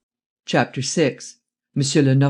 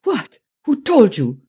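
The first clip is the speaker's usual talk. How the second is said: put-on high, startled German-accented outburst; spluttering, taken aback; 8 semitones higher, 4 dB louder, slower than usual. A narrator's reading. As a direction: splutter in astonishment, pitch shooting up. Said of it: "He spluttered out again".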